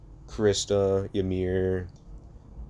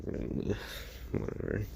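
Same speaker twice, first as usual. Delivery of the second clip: sulky whatever; 11 dB quieter than usual. Acted. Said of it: "shut up and be like".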